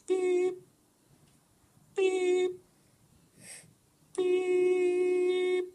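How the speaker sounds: high, monotone beeps, widely spaced; one high beep at a time, the last held long; imitated keypad beeps. Imitating slow, careful button presses, high held beeps. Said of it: "I press each button".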